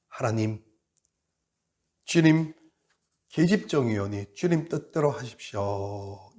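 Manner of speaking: soft, slow, humble surrender; humble prayer. Acted. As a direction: pray it quietly, yielding to God. (조용하고 느린 순종의 기도)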